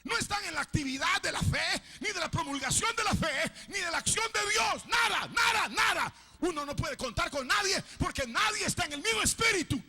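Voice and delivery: loud, high, slowing hammer blows; shouted pulpit rebuke; fiery rebuking sermon. Acted. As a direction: pound each phrase at the congregation. Preacher shouting again.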